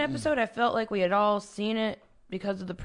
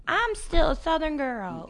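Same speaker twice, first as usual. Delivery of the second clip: slow, higher attempt at a Southern accent; bad Southern accent; 9 semitones higher, slower than usual. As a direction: try a Southern girl accent and miss. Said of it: "that was not a Southern accent at all".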